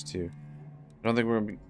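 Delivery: usual speaking voice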